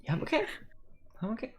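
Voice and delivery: high voice fading to soft; voice disappearing. Acted. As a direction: let the voice vanish. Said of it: "His voice starts to, like, disappear".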